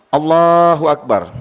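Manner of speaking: long held first word, low and measured; imam's takbir. Shown as a demonstration. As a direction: intone it as if opening a prayer. Demonstrating the opening takbir of prayer.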